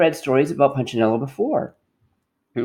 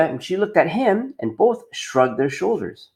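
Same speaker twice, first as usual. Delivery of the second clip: usual speaking voice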